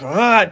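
short, loud imitated grunt; near-yelled growl. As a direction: growl it out, almost yelling. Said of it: "almost yelling, you know?".

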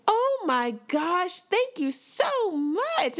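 very high, slow, delighted thank-you; gushing high thanks. Retold or imitated. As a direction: squeal thanks to a little kid. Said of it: "I'm like".

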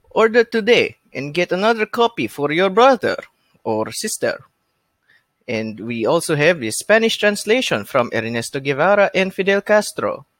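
hammy Russian infomercial sign-off; cheesy Russian accent. Acted. Podcaster ends the joke advert for the book, cheesy Russian accent. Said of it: "almost stereotypical Russian accent".

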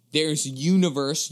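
universe stretched slowly with a rising tune; drawn-out universe. Shown as a demonstration. Spelling it out to the listeners, slow rising universe. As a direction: lean on universe and let it rise. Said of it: "U N I V E R S E".

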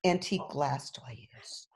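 ordinary talk, nothing marked

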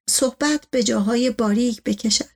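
usual speaking voice

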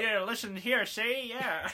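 put-on high, nasal-free gangster patter from old radio; old-timey radio mafia guy. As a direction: mid atlantic mobster voice. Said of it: "Old-timey radio mafia guy".

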